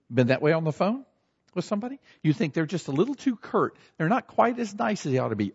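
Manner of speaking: usual speaking voice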